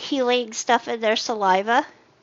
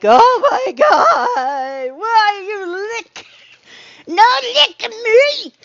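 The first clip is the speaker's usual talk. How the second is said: high, slow, loud crying wail; mock panic; 8 semitones higher, 7 dB louder, much slower than usual. Streamer retelling her reaction to chat, mock crying wail. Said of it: "I was like".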